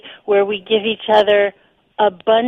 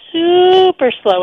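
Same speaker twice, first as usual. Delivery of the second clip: SUPER stretched long and pitched up; super with a long, higher, swelling first vowel; drawn-out emphasis; 10 semitones higher, 5 dB louder, slower than usual. Shown as a demonstration. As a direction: draw out the first syllable of super. Demonstrating degrees of slow in speech.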